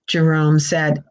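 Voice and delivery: usual speaking voice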